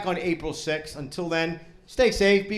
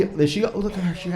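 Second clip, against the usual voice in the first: ordinary talk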